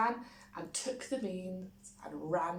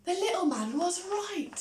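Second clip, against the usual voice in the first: high, louder delight; excited realisation; 12 semitones higher, 5 dB louder than usual. A narrator's reading. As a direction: gasp it out as good news. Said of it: "thought Jack".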